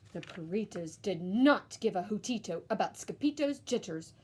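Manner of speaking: plain reading voice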